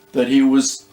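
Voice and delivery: usual speaking voice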